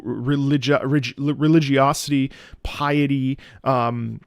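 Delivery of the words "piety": normal talking voice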